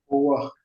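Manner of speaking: po wa said high and clear; po wa demo. Shown as a demonstration. Teacher repeating the word for learners. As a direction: lift po wa up high.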